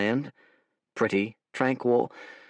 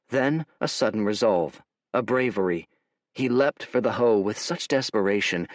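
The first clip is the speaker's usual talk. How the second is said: usual speaking voice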